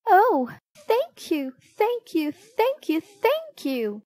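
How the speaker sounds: very high, excited gratitude; acted delight, starting with a loud burst; gushing thanks. A narrator's reading. Family gushes thanks for wrapped present.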